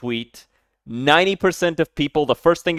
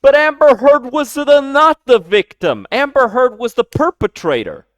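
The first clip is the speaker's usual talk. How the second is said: loud, insistent rant; heated yelling; 8 semitones higher, 5 dB louder than usual. Real, not acted. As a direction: hammer the point home, loud. Heated rant about trial.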